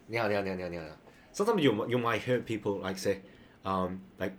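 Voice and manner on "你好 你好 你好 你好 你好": 你好 rattled off over and over, syllables run together; quick repeated greeting. Shown as a demonstration. Imitating what you might hear people say, quick repeated greeting. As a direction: repeat 你好 rapidly in one casual string. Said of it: "quickly com-, replicate this".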